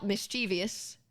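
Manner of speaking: "mischievous" as mis-CHEE-vee-us; four syllables in "mischievous", stress on CHEE; common mispronunciation. Shown as a demonstration. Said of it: "then you're wrong".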